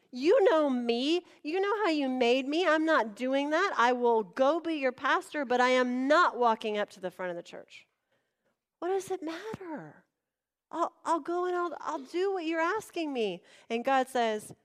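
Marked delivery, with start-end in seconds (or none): 0.1-7.9 higher, louder, stressed pleading refusal; acted-out emphatic objection; indignant protest. Acted protest to God's call. 8.8-13.4 high-pitched bargaining, acted out; mock pleading; pleading concession.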